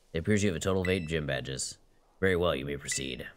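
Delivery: low stiff official; deep formal guard voice. Acted. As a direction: read it like a stern gatekeeper. Voicing guard NPC.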